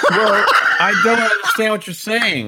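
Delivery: loud, high laughing mock confusion; playing dumb through a laugh; mock bafflement. [name] teases Brad about the game show, loud and laughing.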